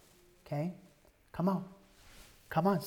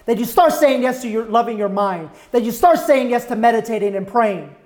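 loud, rising sermon cry; preaching at full force; 5 semitones higher, 16 dB louder than usual. Acted.

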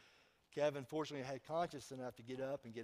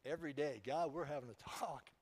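mock talk with God; 5 semitones higher, 12 dB louder, slower than usual. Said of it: "I'd be like".

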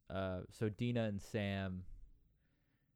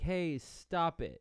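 higher, slow put-on voice; drawn-out scold; mock stern girl; slow stern warning; 7 semitones higher, much slower than usual. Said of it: "she's like".

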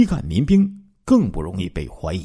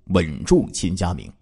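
usual speaking voice

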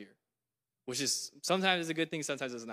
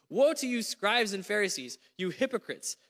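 raised, forceful reading of Jesus' rebuke; preaching scripture; 3 semitones higher than usual. Acted. Preacher reading Jesus' woes to the congregation, forceful. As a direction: proclaim each woe, leaning on it.

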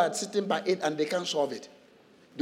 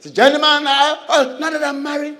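higher and louder, a hammed-up excuse-making husband; mock newlywed husband; 7 semitones higher, 6 dB louder than usual. Preacher mocks a newlywed skipping church, hammed-up. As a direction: play the new husband full of excuses. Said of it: "mocking a newly married man".

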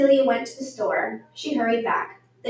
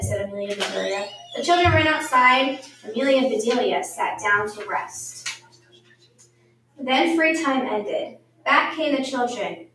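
usual speaking voice

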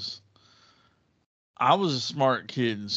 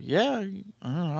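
puzzled drawn yeah; 10 semitones higher than usual. Retold or imitated. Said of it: "I was like".

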